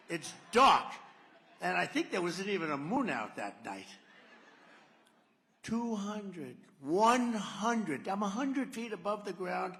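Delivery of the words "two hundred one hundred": higher machine countdown that gets louder; computer callout. Retold or imitated. To the crowd, the plane's altitude computer nearing the ground. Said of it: "a computer voice".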